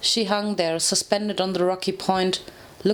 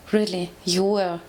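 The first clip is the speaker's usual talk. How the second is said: voice breaking. Acted. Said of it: "my voice breaking in sorrow".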